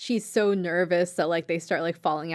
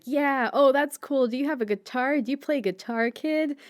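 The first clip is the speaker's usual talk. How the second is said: put-on tipsy drunk lady; 6 semitones higher than usual. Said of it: "she's like".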